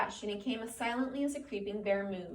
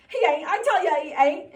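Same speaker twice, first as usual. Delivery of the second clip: panicked; 8 semitones higher, 13 dB louder than usual. Acted. Lenny panicking.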